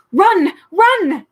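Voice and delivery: very loud, high urgent call; cried out. A narrator's reading. A hidden voice warning the travellers, urgent cry. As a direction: shout a warning. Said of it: "a voice cried out".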